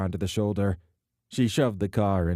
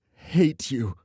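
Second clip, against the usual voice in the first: tearful hate; 6 semitones higher, 4 dB louder than usual. Acted. Alana repeats, crying.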